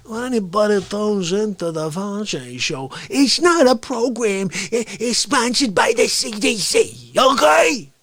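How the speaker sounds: high, slow, hammed-up boasting voice; exasperated blowhard character. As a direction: drag out the big words, building to yells.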